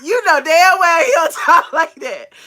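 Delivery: loud, laughing and slow; laughing shout. Real, not acted. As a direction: call him out through laughter. Laughing, calling Josh out.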